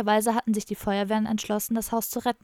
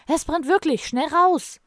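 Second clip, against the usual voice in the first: higher and louder, urgent warning; alarmed shout; 7 semitones higher, 7 dB louder, slower than usual. Acted. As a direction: yell it out of the doorway: fire!. Fire alarm shouted from doorway. (laut und alarmiert rufen)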